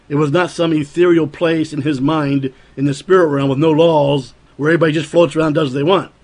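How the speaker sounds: usual speaking voice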